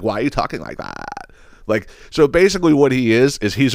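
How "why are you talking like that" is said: soft mocking question sinking low at the end; mimicking affected voice. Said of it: "So he's like".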